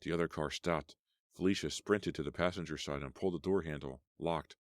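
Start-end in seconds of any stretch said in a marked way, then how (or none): none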